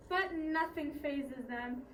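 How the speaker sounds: usual speaking voice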